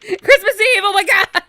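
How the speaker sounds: high-pitched, loud excited cry; excited outburst. Real, not acted. Player finding out it is Christmas Eve, excited. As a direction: squeal it out loud.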